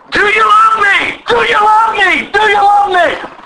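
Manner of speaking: high, ringing repeated question; urgent pleading demand. Retold or imitated. Jesus pressing Peter for love, high and urgent. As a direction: drive each question home at high pitch.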